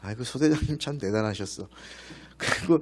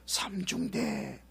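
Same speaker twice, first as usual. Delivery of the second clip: calling from the hilltop; 10 semitones higher, much slower than usual. Retold or imitated. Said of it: "이런 소리들이 들리는 거예요".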